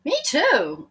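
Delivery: light high voice; high small voice. Acted. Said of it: "said Nathaniel".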